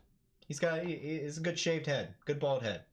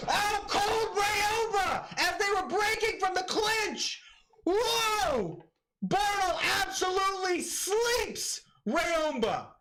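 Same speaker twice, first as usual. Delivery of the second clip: excited shouting; slower than usual. Real, not acted. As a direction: scream the knockout call way up high. Live reaction to a knockout.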